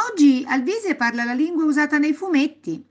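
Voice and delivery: high, loud, cheerful announcement; smiling announcement. A narrator's reading. Grandmother telling grandpa at the door, smiling announcement. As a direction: proclaim it brightly with a smile. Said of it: "disse sorridendo di nuovo la nonna".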